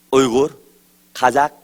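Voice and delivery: ordinary delivery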